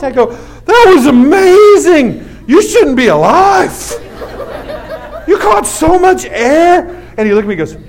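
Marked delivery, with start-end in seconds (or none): 0.6-6.9 high, loud, slow gushing hype; over-the-top cheerleading dad; acted amazement. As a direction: shout it with stretched-out awe. Acts hyping up his son.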